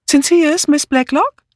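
high-pitched woman's call. A narrator's reading. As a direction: call up to someone out of sight. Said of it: "woraufhin sie den Kopf wandte und rief". (hoch rufende Frauenstimme)

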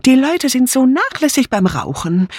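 high, fussy tut-tutting; disapproving. A narrator's reading. Dora Banner complaining to the guests, high and disapproving. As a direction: scold like a fussy old maid. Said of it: "bemerkte Dora Banner missbilligend".

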